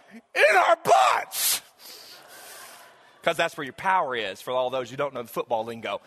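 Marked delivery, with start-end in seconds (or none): 0.1-1.6 high, drawn-out chanted shout; imitating a squad of boys yelling back; team yell. Third and fourth grade boys answering their coach, yelling. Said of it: "And they all yell".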